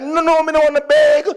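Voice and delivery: high, hammed-up foreign twang; put-on twang. Returning Jamaican showing off to his own, mock foreign twang. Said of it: "I began to twang them like".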